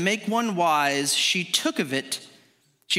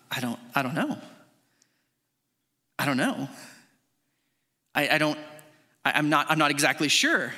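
hesitant, halting doubt with long pauses; unsure; 4 semitones lower than usual. Acted. Eve answering the serpent's question, hesitant and unsure. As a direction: stammer it out, lower and uncertain. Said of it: "she wasn't quite as sure as she thought".

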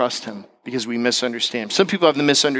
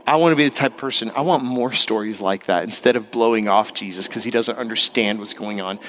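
ordinary talk

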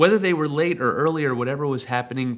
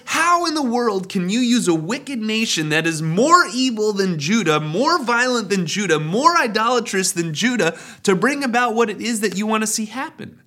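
higher, louder exasperated pleading; a prophet venting at God, higher and louder; frustrated complaint; 8 semitones higher, 4 dB louder than usual. Retold or imitated. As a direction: pile on the outrage with each "more". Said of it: "even a stronger frustration".

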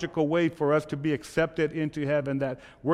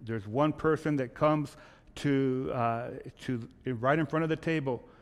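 normal talking voice

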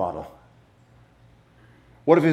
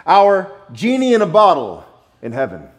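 mock misquote; 5 semitones higher, 7 dB louder than usual. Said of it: "Wait, no".

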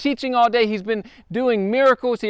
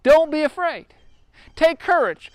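higher, bold call of comfort; Jesus reassuring; 6 semitones higher than usual. Retold or imitated. Preacher voicing Jesus to the frightened disciples, raised and reassuring. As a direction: lift the voice to reassure scared men. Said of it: "He says".

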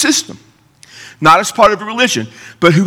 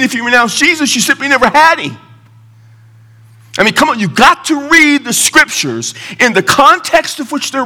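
impassioned preaching, pitched up; żarliwe kazanie; 7 semitones higher than usual. Real, not acted. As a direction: press the argument on the congregation. Preacher argues from scripture.

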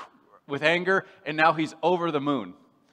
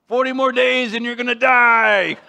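loud, higher, laughing through the threat; Jonah's gleeful doom; 6 semitones higher, 8 dB louder than usual. Acted. Jonah walking through Nineveh, relishing the doom, gleeful and laughing. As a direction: gloat over the city's coming doom. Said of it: "that's his mindset".

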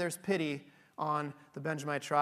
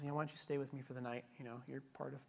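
hushed kindly host; 5 semitones lower, 10 dB quieter than usual. Retold or imitated. Old host welcomes the travellers.

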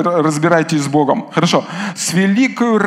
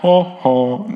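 a posh English chuckle, hammed up; English accent laugh; 2 semitones lower than usual. Shown as a demonstration. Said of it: "с английским акцентом". (смех с английским акцентом)

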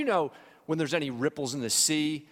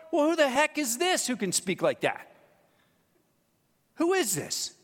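high, loud amazed question; astonished disciples; 10 semitones higher, 4 dB louder than usual. Retold or imitated. Disciples amazed after the storm stops, loud astonishment. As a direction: marvel at who this man is. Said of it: "what the disciples said".

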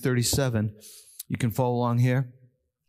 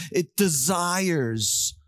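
usual speaking voice

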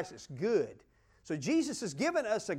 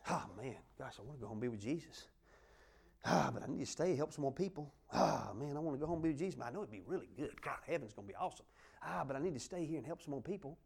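much softer, lower, hushed musing; inner struggle; 8 semitones lower, 12 dB quieter, faster than usual. Pretend. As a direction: torn thoughts muttered alone at night. Imagined night-time inner struggle.

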